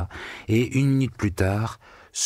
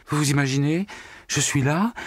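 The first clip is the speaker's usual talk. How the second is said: higher, upset storytelling voice; distraught telling; 6 semitones higher than usual. A narrator's reading. Secretary telling the accountant her story, upset and higher. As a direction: tell it all shaken up. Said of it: "racontait toute bouleversée".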